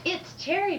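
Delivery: high, lightly voiced schoolgirl; little girl answering. First grader answering teacher, a hint of eager child. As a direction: pipe up with the answer. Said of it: "First little girl".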